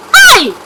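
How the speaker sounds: high, loud exclamation; short high-pitched yelp; sharp outcry. Acted. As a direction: cry out in protest, high and sudden. Startled outcry in heated argument.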